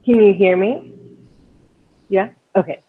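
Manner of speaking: ordinary delivery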